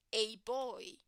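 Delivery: ordinary delivery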